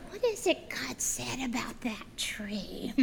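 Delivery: slow, hushed tempter's whisper; whispering serpent. Acted. Serpent asking Eve about the tree, slow sly whisper. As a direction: whisper the question slowly, planting doubt.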